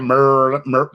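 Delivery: "Mer-, mer-" with the R dragged out; imitating her pronunciation. Teasing a friend about saying Maryland, R dragged on "Mer-". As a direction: mimic a heavy, held R on "Mer-, mer-". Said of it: "see how she said".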